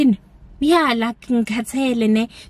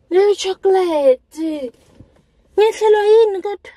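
loud high dramatic lament; dramatic outcry; 11 semitones higher, 4 dB louder than usual. Real, not acted. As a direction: cry out at the injustice. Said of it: "what a dramatic intro".

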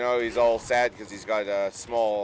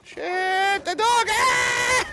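much higher, loud scream of panic; panicked shriek; 5 dB louder, much slower than usual. Real, not acted. Player shrieking as the game dog lunges, panicked.

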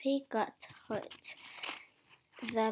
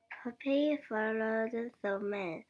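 ordinary delivery

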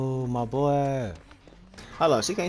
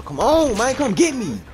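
much higher, louder pleading call; desperate yell; 14 semitones higher, 6 dB louder than usual. Real, not acted. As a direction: scream for your teammates to save you. Begging teammates for rescue.

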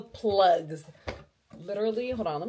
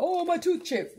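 higher, whiny self-mockery; mock crying complaint; 7 semitones higher, 4 dB louder than usual. Mocking own cry over a chipped tooth, higher whine. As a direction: mimic your own whining, pitched up. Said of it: "then I wanna cry about".

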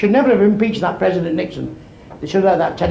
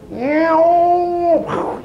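high, drawn-out mock cat meows; 10 semitones higher than usual. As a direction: yowl like cats on a wall. Cat yowl on the roof.

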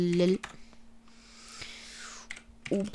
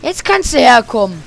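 loud taunt at the enemy; yelling across the room; 3 semitones higher, 13 dB louder than usual. Real, not acted. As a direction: call the enemy over loudly.

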